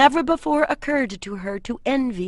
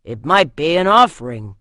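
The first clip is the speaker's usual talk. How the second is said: warning; 4 semitones lower, 9 dB louder than usual. A narrator's reading. Same warrior continues.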